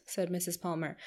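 ordinary delivery, nothing marked

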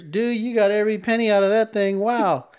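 high-pitched impressed disbelief; acting out an astonished clerk; amazed store employee. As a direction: marvel at the customer's luck.

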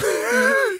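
a relieved burst of laughter; laughing with relief; high giddy laugh. Real, not acted.

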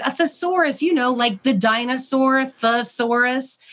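high-pitched mock shout without real volume; mock calling out. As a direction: imitate calling an answer across the house. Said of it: "We're screaming".

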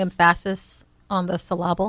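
"emphasis" stressed on PHAS, "syllable" on LAB; deliberately wrong stress on "emphasis" and "syllable"; misplaced stress. Pretend. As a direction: say em-FASS-is and suh-LAB-ul, joking.

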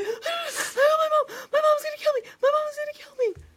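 very high, loud, mock-hysterical squeal; hyperventilating, imitated. Host imitates friend dreading her mom, mock hysterics. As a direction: wail it fast in exaggerated panic. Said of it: "Summer starts hyperventilating".

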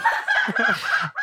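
a breathy laugh at his own punchline; real laugh. Real, not acted. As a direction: laugh at the joke.

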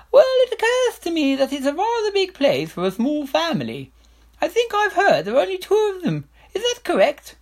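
high, loud royal voice; scheming king. Acted. King nudging Herringbone to move the family, high and scheming. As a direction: sound reasonable while scheming.

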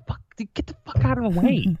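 high-pitched exasperated outburst; comic fed-up yelp without real volume; retold snapping. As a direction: snap at someone blocking you, retold for laughs. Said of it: "I snapped and was like".

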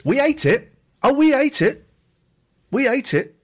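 proudly telling how they finished the pizza; triumphant. Real, not acted. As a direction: crow about finishing it. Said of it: "proud triumphant repetition".

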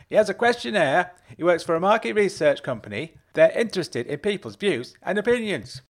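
shouted. A narrator's reading. Mum shouts the visitor's words to her husband. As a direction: shout the message back to the husband. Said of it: "turned back to the house and shouted".

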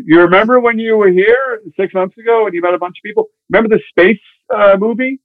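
imitating the LA agent prompting him; pushy agent. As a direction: nudge his memory like a salesman. Hollywood agent voiced on phone.

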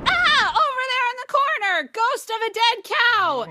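high loud mock-scared yell, slowing down; fake alarmed shout. Nurse yelling at Mildred to make her look away, high mock-scared shout. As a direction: yell it to distract, pointing at the corner. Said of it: "and she goes".

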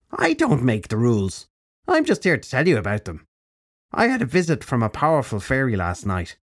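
put-on high, bright-edged old witch; old crone voice. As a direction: speak as a brisk old crone. Crone character voice.

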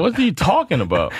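high, exasperated disbelief; incredulous. Real, not acted. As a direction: throw the question out in disbelief. Rhetorical outburst at the coach.

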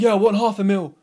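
loud, insistent mock seller; imitated business seller. Business owner demanding half a million, loudly imitated. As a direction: push it out loud as an overvaluing owner. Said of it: "people are like".

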